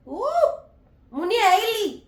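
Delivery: high, loud whoop; bursting exclamation. Real, not acted. Vlogger snaps out of sadness with a loud high whoop. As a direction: shake off the tears with a loud cry.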